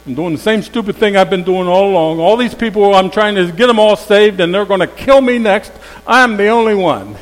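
high, loud self-pitying complaint; whiny Elijah. Acted. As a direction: moan it like a sulky prophet. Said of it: "he's having a pity party".

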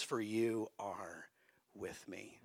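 slow, soft and whispered at the end; hushed whisper. Shown as a demonstration. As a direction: lean in and whisper the promise. Softly repeating the verse.

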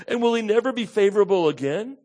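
high, loud anguished questions; acted impassioned lament; pained doubting. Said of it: "when we are in deep pain".

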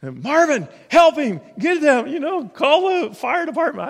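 high, loud panicked cry; frantic mom yelling for help; freaked-out mom. Retold or imitated. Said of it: "freaked out because she freaks out really quick".

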